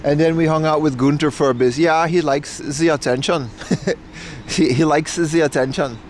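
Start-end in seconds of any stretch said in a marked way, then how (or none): 1.8-3.5 put-on German-accented English, "the" as "ze"; imitated German accent. Dog owner explaining her dachshund to neighbours, imitated German accent. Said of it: "If you ever heard a German speak English". 4.5-6.0 mock German English, "the" turned to "ze"; imitated German accent. Repeating the dog owner's remark for chat, imitated German accent. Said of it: "If you ever heard a German speak English".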